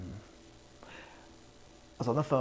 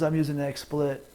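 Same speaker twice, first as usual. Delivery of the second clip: ordinary talk, nothing marked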